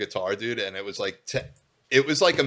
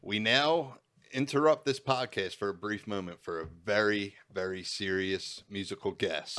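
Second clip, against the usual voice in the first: mock announcer; 4 dB quieter than usual. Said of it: "announces a mock musical break".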